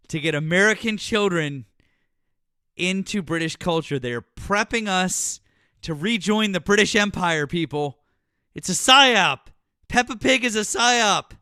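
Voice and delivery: loud, rising, hammed-up ranting; mock conspiracy rant. Warning listeners about Peppa Pig, loud mock rant. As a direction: preach it like a paranoid crank.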